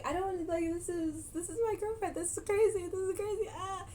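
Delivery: put-on high, panicky boyfriend voice; mock panicked boyfriend. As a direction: boyfriend panicking beside his mom. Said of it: "looking at his mom, like".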